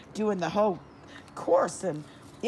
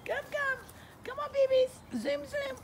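calling the puppies; 4 dB quieter than usual. Real, not acted. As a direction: coax the dogs in a squeaky high voice. Owner calling her puppies.

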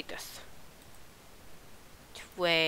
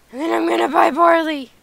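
high, loud hammed-up whine; mock whiny resolve; 8 semitones higher, 6 dB louder than usual. Player announcing the plan to buy barley, mock whiny. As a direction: push it up high, half wailing.

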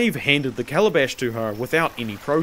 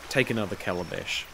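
low, soft, toneless order; flat voice; 6 semitones lower, 7 dB quieter, faster than usual. A narrator's reading. Master shuts his eyes, orders in flat voice. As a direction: say it drained of all expression. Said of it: "he said in a flat voice".

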